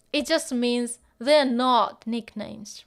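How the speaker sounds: they're not nicknames spoken slowly and firmly; slow down and lean on they're not nicknames; stress on they're. Shown as a demonstration. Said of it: "teacher stressing meaning".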